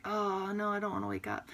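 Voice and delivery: soft mock-groggy voice; sleepy reluctance. Yoga teacher acting out a reluctant waker, groggy.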